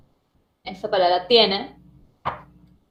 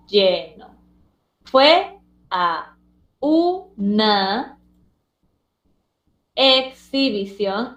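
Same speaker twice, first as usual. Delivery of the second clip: "lleno. Fue a una exhibición" in slow separated syllables; much slower than usual. Shown as a demonstration. Teacher showing confused pupils the split, "lleno. Fue a una exhibición" syllable by syllable. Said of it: "Vamos a mostrar entonces".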